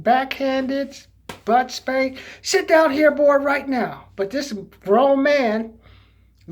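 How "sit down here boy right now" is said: raised, higher, louder parental command; stern mock-parent telling-off; scolding parent. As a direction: order a misbehaving child to sit. Voicing a parent disciplining him.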